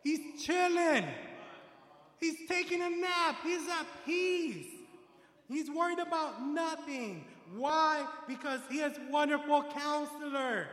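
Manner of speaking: rising fervent sermon voice, swelling loud on the titles; full-force preaching. Real, not acted. Preacher to congregation about the storm, full-force preaching.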